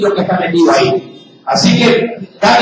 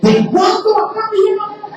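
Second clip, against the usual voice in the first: put-on kids' suspicious reply; 10 semitones higher than usual. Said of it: "vienen los muchachos y dicen".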